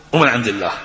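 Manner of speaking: normal talking voice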